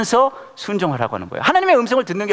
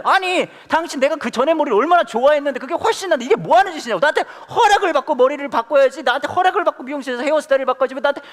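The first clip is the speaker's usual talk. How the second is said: high-pitched, ranting husband, hammed-up; angry husband; 10 semitones higher than usual. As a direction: rattle off an indignant tirade, comically high. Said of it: "막 화를 내요". (화내며 따지는 남편 흉내)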